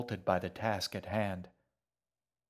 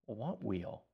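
inner thought; 6 dB quieter than usual. A narrator's reading. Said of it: "she thought".

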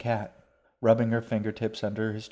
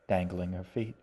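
plain reading voice

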